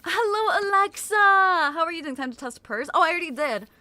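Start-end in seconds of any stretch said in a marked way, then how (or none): 0.1-2.2 excited greeting. Real, not acted. Streamer welcoming a viewer to chat, excited high greeting. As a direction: sing out a delighted welcome.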